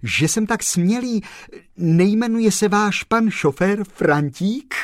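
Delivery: high, excited, urgent question; blurted out. A narrator's reading. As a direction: burst out with a sudden realisation.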